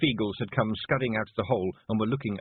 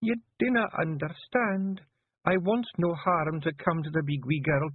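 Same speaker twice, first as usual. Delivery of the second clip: higher Scots wife, earnest plea; pleading wife; 12 semitones higher than usual. A narrator's reading. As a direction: beg him not to go. Jeanie pleading with her husband.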